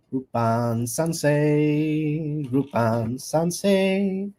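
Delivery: very slow, stretched name; drawn-out sing-song. Pretend. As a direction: stretch each syllable out slowly. Drawn-out greeting to viewer.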